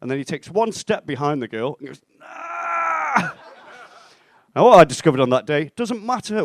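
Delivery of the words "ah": long, high-pitched "aah"; mock high squeal. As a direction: let out a sustained, hammed-up squeal. Friend's silent taunt behind the girl.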